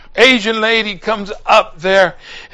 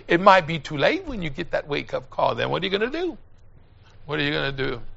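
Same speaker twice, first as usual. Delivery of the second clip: much softer, pleading question; a soft, grave warning to the listeners; quiet sermon close; 3 semitones lower, 8 dB quieter, faster than usual. Acted. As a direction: drop down low and let it sink in. Sermon drops to hush.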